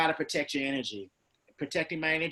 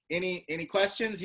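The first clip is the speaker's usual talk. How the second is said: usual speaking voice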